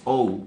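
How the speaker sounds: "O" said as English "oh", gliding to u; natural vowel sound. Shown as a demonstration. Teacher shows learners the natural sound, "O" as English "ou". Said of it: "su sonido natural es".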